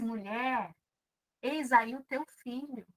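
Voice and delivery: gently, as if at the cross; soft, moved reading. Shown as a demonstration. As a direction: say it softly, full of feeling. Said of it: "Agora vamos fazer de novo?". (com ternura, baixinho)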